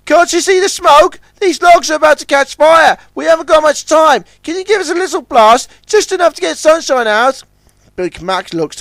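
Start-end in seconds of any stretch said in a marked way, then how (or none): 0.1-7.4 very high, loud eager tug voice; bright busy little tug in a hurry; tug Ten Cents. Acted. Ten Cents talks with Puffer, high and urgent.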